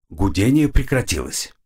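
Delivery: plain reading voice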